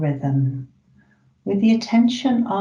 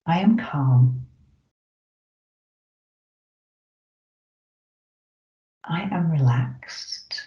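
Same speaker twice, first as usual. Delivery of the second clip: slow affirmations; slower than usual. Shown as a demonstration. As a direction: let each affirmation land slowly. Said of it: "the first one is".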